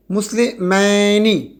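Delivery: مسلمینی drawn out very slowly; slow demo. Shown as a demonstration. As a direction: stretch it so the ینی ending is heard. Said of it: "حالت نصب میں اور جر میں کیا ہوگا". (بہت آہستہ کھینچا ہوا لفظ)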